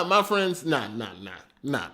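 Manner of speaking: ordinary talk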